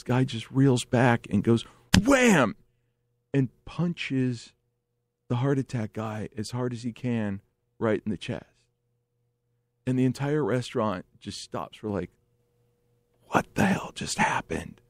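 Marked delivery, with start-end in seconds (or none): 2.0-2.5 high, sudden falling "wham"; explosive high-pitched impact word; punch sound effect. Retold or imitated. 13.3-14.8 low, hushed, stunned question; whispery shocked reaction; stunned disbelief. Retold or imitated.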